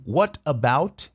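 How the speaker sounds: "What about?" said very slowly, the words joined; slow linked what about. Shown as a demonstration. Teacher slowing "What about?" for students. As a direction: stretch out "What about?" smoothly. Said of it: "Ele junta tudo".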